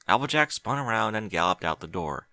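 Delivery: ordinary delivery, nothing marked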